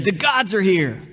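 high, excited proclamation at moderate volume; mock crowd yell. Preacher voicing the crowd hailing gods, mock excited yell. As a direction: call it out like an amazed crowd. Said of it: "The crowds are getting loud. They're yelling and screaming".

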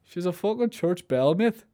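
a hint of a gossiping local voice; imitating a local. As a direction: toss it off like a bloke down the pub. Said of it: "You can definitely hear people saying that".

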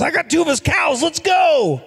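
a cheeky grin in the voice; high gleeful shout; gleeful Mickey Mantle. Retold or imitated. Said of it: "He goes".

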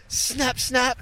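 two high, sudden snaps; imitated snapping sound. Both fishing rods hit the bridge, sudden imitated snaps. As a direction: say it like rods cracking overhead. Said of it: "this sound goes off".

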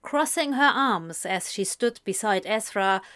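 ordinary delivery, nothing marked

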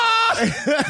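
high burst of laughing shout; shocked laugh. Real, not acted. Recalling the twist, he bursts into a high shocked laugh.

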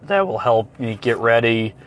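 ordinary talk

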